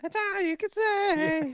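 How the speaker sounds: very high squeaky echo; mock taunting. Echoing the Dee Dee taunt.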